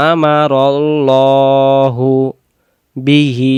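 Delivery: very slow chant with a heavy, full l in Allah; Quran recitation. Shown as a demonstration. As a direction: hold each syllable long. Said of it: "teacher chants the verse". (মোটা করে টেনে তিলাওয়াত)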